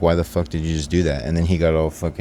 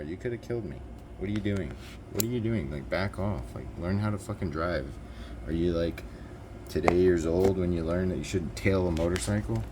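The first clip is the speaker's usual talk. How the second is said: soft, incredulous scolding; mouthed protest; 6 dB quieter than usual. Retold or imitated. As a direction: act out mouthing it through a car window, barely voiced. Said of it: "I'm, like, mouthing it to him".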